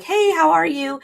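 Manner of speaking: high, bright hello in a retold scene; imitated cheery welcome; cheery greeting. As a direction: act out a chirpy greeting, pitched well up. Greets arriving guests in retold scene.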